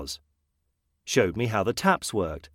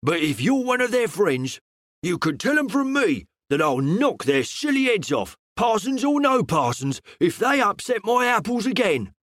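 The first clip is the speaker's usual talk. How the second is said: high, heated voice, climbing to much higher; angry stammer; 8 semitones higher than usual. Acted. Tradesman threatens the clergymen over his apples, angry sputter. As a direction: sputter it out in indignant rage. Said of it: "he stammered angrily".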